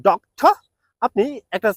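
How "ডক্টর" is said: ডক্টর said as dok-tuh, no r, raised; correct pronunciation. Shown as a demonstration. Teacher modelling doctor for Bengali learners. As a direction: model it crisply and up. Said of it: "উচ্চারণ করেন, তাহলে সেটা হবে".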